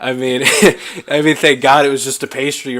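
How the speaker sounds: loud laugh through the words. Real, not acted. Host laughing at the pastry story, loud and laughing. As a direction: burst out laughing as you speak.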